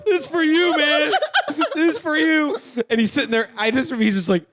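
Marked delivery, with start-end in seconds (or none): 0.0-2.6 high, half-sobbing, half-laughing plea; laugh-crying. Retold or imitated. Little brother handing over his savings, laugh-crying. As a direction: gush it out through laughter and tears. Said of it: "I'm crying".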